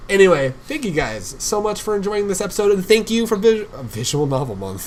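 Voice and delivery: high, loud host outro; excited sign-off. Real, not acted. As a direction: thank the audience with big, loud energy. Host's outro thanks.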